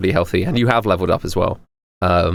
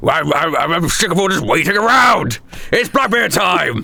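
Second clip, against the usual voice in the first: impatient pirate; 7 semitones higher than usual. Acted. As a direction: stretch out "Blackbeard time" as a battle cry. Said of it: "Blackbeard took no damage".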